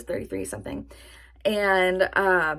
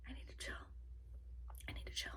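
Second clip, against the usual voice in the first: whisper; 25 dB quieter, much faster than usual. Real, not acted. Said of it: "Stop making me laugh".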